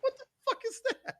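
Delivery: a shrieking laugh of surprise; laughing squeal. Real, not acted. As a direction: squeak it out high through a laugh. Laughing reaction to something.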